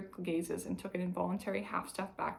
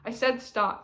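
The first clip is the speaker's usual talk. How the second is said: loud, higher call; 4 semitones higher, 7 dB louder, slower than usual. A narrator's reading. Basil calling after the fleeing man, loud. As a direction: call out firmly after him. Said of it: "Basil called again".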